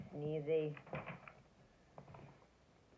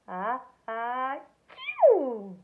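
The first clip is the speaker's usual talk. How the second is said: loud building sneeze; gigantic sneeze; 5 semitones higher, 10 dB louder, much slower than usual. A narrator's reading. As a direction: wind up and explode the sneeze. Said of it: "sneezed a gigantic".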